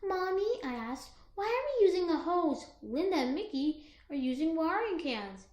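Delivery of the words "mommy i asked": plain reading voice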